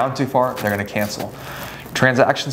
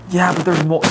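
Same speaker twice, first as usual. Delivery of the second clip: higher-pitched worried buyer voice; objecting client; 7 semitones higher than usual. Acted. Buyer protesting to agent about mold, fretful. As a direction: butt in with a mock fretful protest.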